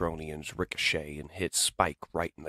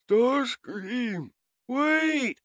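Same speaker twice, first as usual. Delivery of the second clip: wounded robot calling out; 5 dB louder, much slower than usual. Acted. Hurt robot calls after his comrade.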